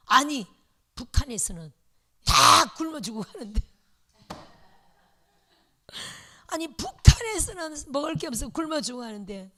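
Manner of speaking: put-on loud indignant rebuke of an old deaconess; angry scolding. Old deaconess scolding the young pastor's wife, loud and angry. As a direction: scold loudly as if outraged. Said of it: "막 역정을 내는 거예요".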